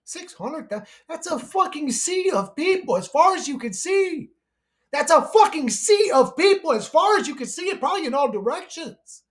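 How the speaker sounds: high, loud, incredulous outrage; outraged rant. Real, not acted. Railing at listeners over missing children, high and outraged. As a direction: rail at the scale of it.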